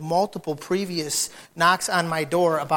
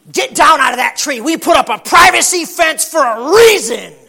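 higher, louder scolding shout; angry neighbor yelling; 8 semitones higher, 13 dB louder than usual. Retold or imitated. Neighbor shouting at his daughter in the tree. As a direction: yell at the kid to get out of the tree. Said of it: "daughter climbs the tree".

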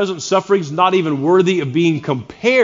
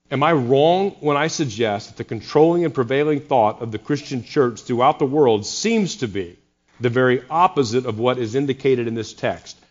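ordinary delivery

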